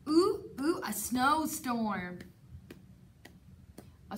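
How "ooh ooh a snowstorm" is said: ordinary delivery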